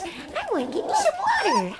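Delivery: dog voice. Retold or imitated. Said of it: "He says".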